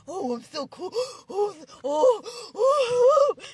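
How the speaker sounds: hammed-up breathless posing; mock gasping cool guy. Mocking show-offs, a gasping preening oh.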